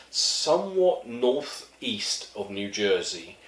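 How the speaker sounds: drawn-out, measured answer; slow, deliberate reply. Retold or imitated. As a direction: spell it out slowly, word by word. Deadpan answer to girl's wrong guess.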